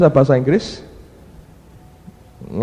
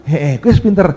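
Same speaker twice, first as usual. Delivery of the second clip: laughing approval; 3 semitones higher, 4 dB louder than usual. Retold or imitated. Teacher answers laughing.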